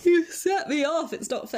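mid-yawn complaint. Real, not acted. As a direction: say it through a yawn. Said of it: "You're yawning too now".

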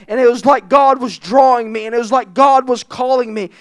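normal talking voice

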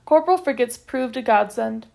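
ordinary delivery

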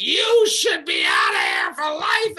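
high, loud banishing yell; furious shout. Retold or imitated. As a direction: yell her out for good. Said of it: "And they're like".

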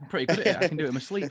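loud, high cocky brag; jokey boast. Real, not acted. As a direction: show off playfully. Repeats the joking boast.

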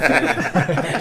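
ordinary talk, nothing marked

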